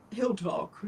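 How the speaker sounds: flat, lightly British god's threat; British accent. Retold or imitated. The Egyptian god saying he'll talk, lightly British. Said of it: "you know, in his British accent".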